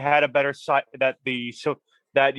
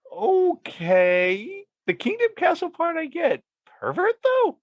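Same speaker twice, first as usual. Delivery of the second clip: high-pitched disbelief; rising to a squeak of disbelief; incredulous; slower than usual. Real, not acted. Friend stunned by pervert castle.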